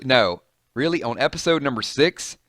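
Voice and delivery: ordinary delivery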